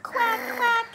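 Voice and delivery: high, loud cooing with squeals. Real, not acted. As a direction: gush over the little duck. Cooing to baby in bath.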